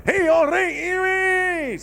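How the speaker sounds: high, drawn-out shout in a put-on Scottish accent; mock Scottish accent. Canadian comic risking a smack by trying it, mock Scottish accent. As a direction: holler a long, high mock-Scottish greeting. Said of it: "not brave enough to try any Scottish accent".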